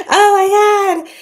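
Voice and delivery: high, loud, slow through laughter; laughing. Real, not acted. Streamer cracking up at her chat, laughing hard. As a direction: let it burst out mid-laugh.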